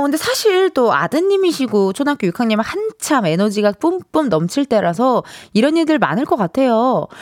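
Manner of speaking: normal talking voice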